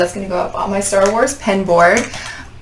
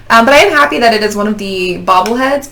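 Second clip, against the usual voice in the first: usual speaking voice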